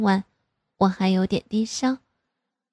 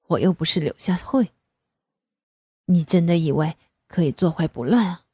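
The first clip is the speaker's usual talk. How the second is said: lower, joking man's voice; chuckling father; 3 semitones lower than usual. A narrator's reading. Father joking back, lower man's voice. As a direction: laugh it off as dad. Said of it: "爸爸也嘻嘻地笑了起来".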